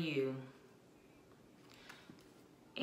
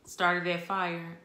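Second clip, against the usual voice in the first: ordinary delivery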